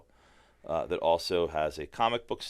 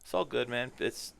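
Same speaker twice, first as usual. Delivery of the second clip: ordinary talk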